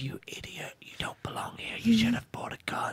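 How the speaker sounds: hissed comic whisper; mock Whisperer. Co-host playing a Whisperer telling off a victim, whispering. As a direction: whisper a scolding for laughs. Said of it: "maybe that's why one of them said".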